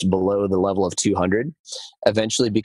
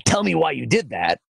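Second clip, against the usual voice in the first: higher, pointed accusing ask; shaming voice; 6 semitones higher than usual. Shown as a demonstration. Coach contrasting kind and harsh questions, shaming. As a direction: demand an explanation, blaming. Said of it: "instead of a shaming voice".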